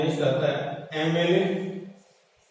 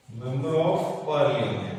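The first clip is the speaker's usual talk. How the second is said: very slow, lower, word by word; slow dictation; 5 semitones lower, much slower than usual. Shown as a demonstration. Teacher repeating MP's full form for students, slow dictation. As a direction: stretch out each word as if dictating.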